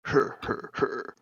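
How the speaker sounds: lightly voiced mocking giant; slow, taunting giant threat; two bickering giant voices. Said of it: "and goes".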